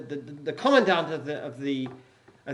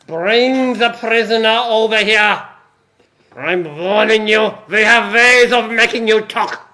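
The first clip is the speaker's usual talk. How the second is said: put-on loud, slow menacing German accent; shouted German accent; 4 semitones higher, 15 dB louder than usual. Commandant threatening the prisoner, loud German accent. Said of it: "heard you do an accent".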